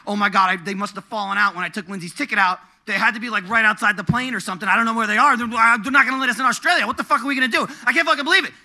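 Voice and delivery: loud, high frantic rambling; retold panic spiralling upward; freaking out. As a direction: build from alarm to outraged disbelief. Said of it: "I'm, like, freaking out".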